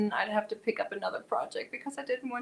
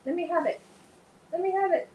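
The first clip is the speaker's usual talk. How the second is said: pet talk; 8 semitones higher, faster than usual. Real, not acted. Coaxing cat for its leg.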